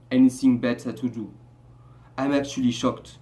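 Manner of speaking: put-on Nigerian English accent, low-pitched. Shown as a demonstration. Vlogger questioning viewers who kept watching, Nigerian accent. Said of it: "I'll make a Nigerian accent".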